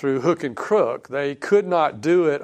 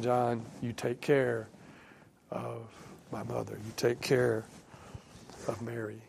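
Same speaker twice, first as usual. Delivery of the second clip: much softer, hushed words of Jesus; soft tender quote; 3 semitones lower, 12 dB quieter than usual. Acted. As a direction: say it gently, almost under the breath, like a dying son's last charge. Jesus voiced entrusting his mother.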